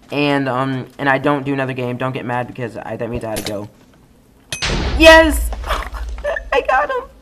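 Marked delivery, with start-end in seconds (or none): 5.0-7.1 high triumphant yell; excited cheer. Real, not acted. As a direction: shout it out, thrilled at the kill. Just got a kill.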